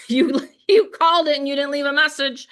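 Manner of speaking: teasing Joel with a laugh in the voice; laughing tease. Real, not acted.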